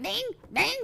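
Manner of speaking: high chiming ding; phone alert ding. Retold or imitated.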